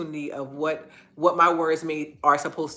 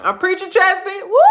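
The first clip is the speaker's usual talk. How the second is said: excited shout-out; 12 semitones higher, 7 dB louder than usual. Real, not acted. Answers chat comment with excitement.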